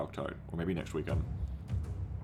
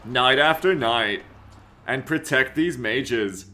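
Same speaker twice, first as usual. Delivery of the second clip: slow, grave trailer voice; trailer narration; 3 semitones higher, 5 dB louder, much slower than usual. Acted. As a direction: epic closing narration. Reads the trailer narration aloud.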